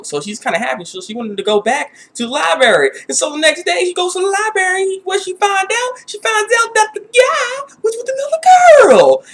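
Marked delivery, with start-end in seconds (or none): 3.1-9.2 rising drama. Real, not acted.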